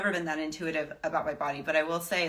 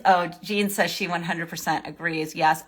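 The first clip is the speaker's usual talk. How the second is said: ordinary delivery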